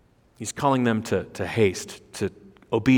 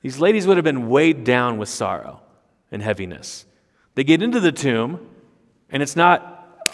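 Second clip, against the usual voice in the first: ordinary talk, nothing marked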